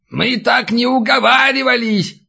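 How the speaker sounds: high-pitched indignant cry; old man's yell. A narrator's reading. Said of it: "заорал снизу старик". (возмущённый крик старика)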